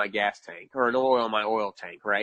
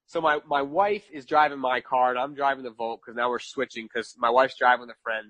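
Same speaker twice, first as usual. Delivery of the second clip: ordinary delivery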